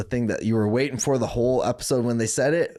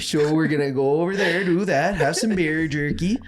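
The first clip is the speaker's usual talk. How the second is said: higher, louder, hammed-up Minnesota accent; Minnesota homies impression; 5 semitones higher, 3 dB louder than usual. Homies back home planning a lake trip, hammed-up Minnesota accent. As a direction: lay the Minnesota vowels on thick. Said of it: "all my homies were like".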